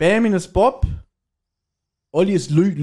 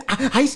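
mock hot yelp; 10 semitones higher than usual. Burning fingers on hot punch.

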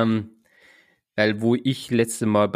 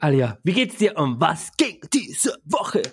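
podcast host voice; 4 semitones higher, slower than usual. Acted. Opening question of podcast episode.

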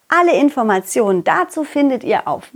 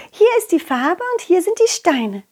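usual speaking voice

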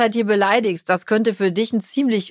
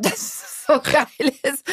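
words breaking into a laugh; giggling while mocking the daughter's intro; 5 semitones higher, much slower than usual. Real, not acted. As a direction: laugh through it, slowing down.

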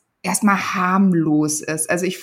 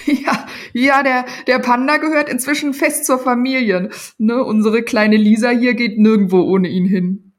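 father talking fast and higher, nervously laughing it off; 3 semitones higher, 4 dB louder than usual. Acted. Father nervously explaining the panda to the police, higher and louder. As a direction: cover up the secret with forced cheer. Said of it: "Papa lachte nervös".

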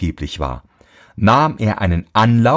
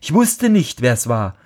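much higher, earnest protest; pleading boy; 9 semitones higher than usual. A narrator's reading. Boy pleads with his scolding father, high and earnest. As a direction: plead your innocence.

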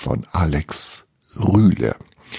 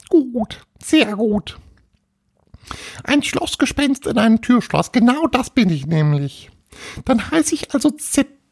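high tiny ghost voice, declaring; self-satisfied little ghost. Acted. Little ghost accepts its new name, high proud voice. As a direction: announce it proudly as a small ghost.

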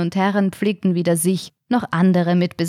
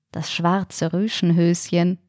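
usual speaking voice

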